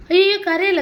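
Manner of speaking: high, loud cry of concern; oh no, don't cry, pitched right up; alarmed soothing. Real, not acted. Mother to crying child at writing practice, alarmed soothing.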